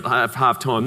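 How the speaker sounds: usual speaking voice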